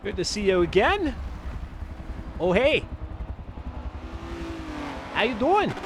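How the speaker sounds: put-on high-pitched botched Canadian accent; attempted Canadian accent that goes astray; horrible Canadian accent. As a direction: greet viewers in a badly faked Canadian accent. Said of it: "That was a horrible Canadian ac".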